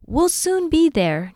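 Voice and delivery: slow, high, loud reassuring shout; shouting back. A narrator's reading. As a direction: shout it back to the others behind you. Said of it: "Jessie shouted back".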